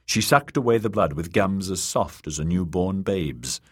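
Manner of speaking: ordinary delivery, nothing marked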